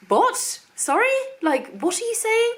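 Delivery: high, incredulous mock disbelief; imitating shocked husband. Imitating her husband hearing she wants the hospital, high mock disbelief. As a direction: play the stunned husband, pitch way up. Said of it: "And Mark was like".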